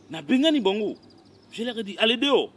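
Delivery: high, loud order to get out; loud, outraged retelling; indignant outburst. Acted. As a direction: raise the voice as if chasing them off. Recounts chasing out two louts.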